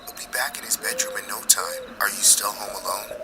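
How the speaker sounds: soft apologetic whisper; secretive murmur into the phone; hushed phone whisper. Acted. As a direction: keep it very quiet so dad won't hear. Boy hears dad, keeps hushed.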